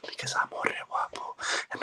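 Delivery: whispered promo sign-off; hushed, menacing last word of a promo. Pretend. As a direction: breathe the final boast as a whisper. Menacing end of his promo.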